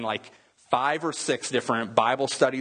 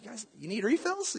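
bright, much higher, friendly offer; cheerful waiter; 11 semitones higher, 5 dB quieter than usual. Retold or imitated. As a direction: play the suddenly happy waiter.

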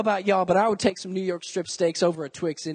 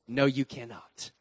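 stern mother; 9 semitones lower, 8 dB quieter than usual. Retold or imitated. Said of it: "those dreaded words".

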